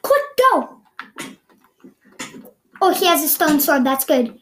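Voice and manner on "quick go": high, loud urgent call; urgent shout. Real, not acted. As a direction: yell it to hurry them. Urgent call during fight.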